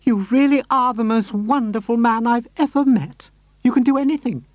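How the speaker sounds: high lady praising him happily; delighted lady. Acted. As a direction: gush with admiration. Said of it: "cried out".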